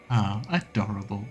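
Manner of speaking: normal talking voice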